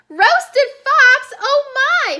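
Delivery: loud, high startled cry; alarmed fox. Acted. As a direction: gasp it out like a frightened fox. Fox alarmed by the mouse's story.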